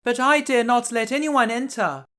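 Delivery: put-on a sweet girl refusing politely.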